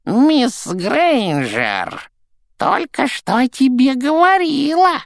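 snide high poltergeist singsong; sly poltergeist. Acted. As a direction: tease her like a mischievous ghost. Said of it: "с ехидным видом шепнул Пивз".

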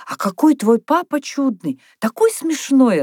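put-on high, amused child's exclamation. A narrator's reading. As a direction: gush with amusement, without a real laugh. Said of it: "рассмеялась она вдруг".

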